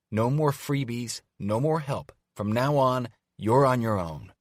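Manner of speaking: loud high slow warning; stern threat. Acted. Evie pointing at Carter's chest, stern threat. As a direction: lay down the law firmly.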